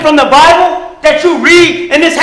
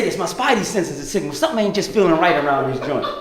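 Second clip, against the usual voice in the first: quiet inner voice; 9 semitones lower, 11 dB quieter, faster than usual. Retold or imitated.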